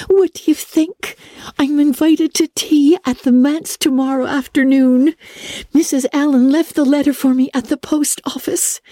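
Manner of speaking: put-on high, breathless girlish thrill, lingering over the address; excited young Anne. Anne showing Marilla her invitation letter, high and thrilled. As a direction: savour every word like a treasure.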